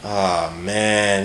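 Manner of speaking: ordinary talk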